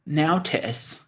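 "Now tis" split in two; "Now" like "cow", then a short "tis"; puzzled imitation of bear-speak "Now tis"; odd baby-talk spelling. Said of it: "is that notice".

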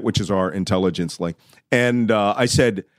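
ordinary delivery

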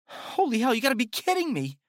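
high, loud, fast disbelief; terrified woman swearing in shock; panicked woman. A narrator's reading. Woman sees the corpses.

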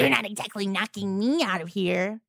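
put-on mimicry, low and quiet; mocking imitation. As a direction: parrot it back to mock them. Mocking repeat of the other's line.

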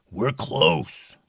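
low dragon growl. Acted. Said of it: "the dragon growled".

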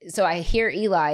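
ordinary delivery, nothing marked

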